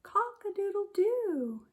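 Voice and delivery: high crowing rooster call. Acted. Teacher crowing like a rooster for the children. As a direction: crow it out like a rooster. Rooster crow in picture book.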